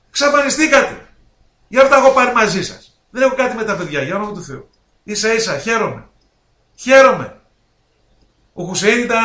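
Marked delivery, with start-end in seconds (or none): 0.1-1.1 ξαφανιστήκατε thrown out higher with a rising tune; ξαφανιστήκατε repeated higher and louder; repeated accusation. Real, not acted. 6.7-7.4 χαίρομαι said again much louder and higher; χαίρομαι, loud and higher, falling at the end; emphatic repeat. Real, not acted.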